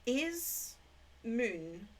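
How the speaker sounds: normal talking voice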